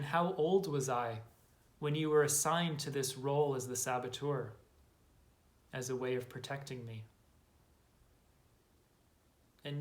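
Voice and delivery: measured, calm inner-journey guidance with long silences; guided meditation. Acted. Guide to listeners exploring their inner saboteur, calm meditation voice. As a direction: invite quiet noticing, letting phrase endings settle softly.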